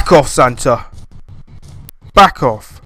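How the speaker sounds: loud defiant yell; angry shout. Real, not acted. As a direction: shout him away. Yelling at monster.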